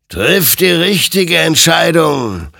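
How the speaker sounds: loud, high, drawn-out warning; stern admonishing. A narrator's reading. Adversary urging Hector to decide rightly, loud stern admonition. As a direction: admonish like an otherworldly judge. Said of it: "ermahnte ihn sein Gegenüber".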